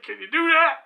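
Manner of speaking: high, loud retold dare; daring challenge. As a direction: goad him to try it. Boss daring Kyle, retold.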